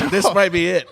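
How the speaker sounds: normal talking voice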